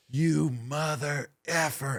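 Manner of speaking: drawn-out imitation of an angry man; really mad, retold. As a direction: imitate slow-burning outrage. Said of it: "he's really mad".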